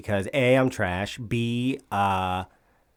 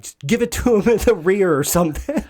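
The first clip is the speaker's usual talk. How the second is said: yelling; 7 semitones higher, 4 dB louder than usual. Retold or imitated. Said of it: "can be heard in the background saying".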